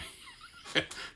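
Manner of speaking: short, breathless real chuckle; amused laugh. Real, not acted. Preacher laughing over his mispronounced words, amused. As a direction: chuckle at your own mistakes.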